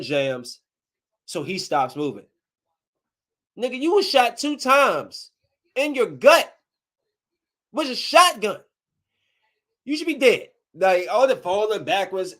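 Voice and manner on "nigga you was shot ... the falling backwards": high, loud outrage at the screen; incredulous yell. Real, not acted. Yelling at the movie villain, incredulous shout. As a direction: call out the character in disbelief.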